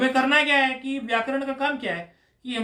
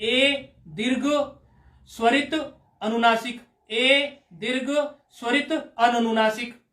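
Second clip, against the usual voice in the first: measured recitation. Shown as a demonstration. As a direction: recite each variety in one fixed pattern, unhurried. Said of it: "जैसे हमें पहले बोलना है कि ए".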